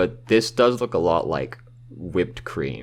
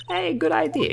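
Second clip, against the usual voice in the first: high character voice. Acted.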